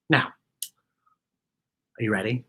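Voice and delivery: usual speaking voice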